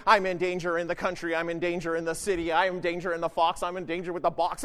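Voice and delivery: low, even rhyming recital; Green Eggs parody. Retold or imitated. Preacher mocks Paul's list of dangers as a children's rhyme, monotone recital. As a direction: reel it off like a droning rhyme. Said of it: "like a bad version of green eggs and ham".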